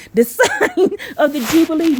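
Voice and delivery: high laugh breaking into the words; laughing while reading. Real, not acted. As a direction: read on through a real laugh. Laughs at own yelling while reading.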